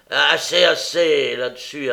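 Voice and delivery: irritated, forceful interjection; impatient interruption. A narrator's reading. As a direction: shut the subject down impatiently. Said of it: "interrompit Sykes avec impatience".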